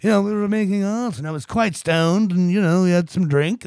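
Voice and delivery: loud, casual excuse; imitating John. John excusing lateness to Paul, loud casual. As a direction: shrug off being late. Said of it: "he's like".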